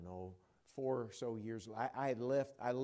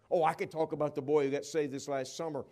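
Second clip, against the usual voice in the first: normal talking voice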